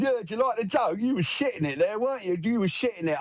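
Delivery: lightly voiced gangster taunting; gloating gangster. Gangster mocking the teller.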